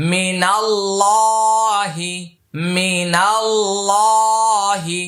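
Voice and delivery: মিনাল্লাহি very slowly, with a thick L and long vowel; heavy lam. Shown as a demonstration. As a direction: intone মিনাল্লাহি, holding the heavy "লা". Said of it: "লামকে মোটা করিয়া পড়িতে". (মিনাল্লাহি-র লাম মোটা করে টেনে পড়া)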